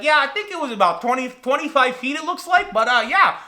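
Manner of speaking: guy from Michigan. Retold or imitated. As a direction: mimic a chatty Midwesterner. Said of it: "some guy from Michigan".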